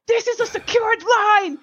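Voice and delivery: high, slow, over-acted indignation; imitated freak-out. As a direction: draw it out like an outraged caller. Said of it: "he starts freaking out".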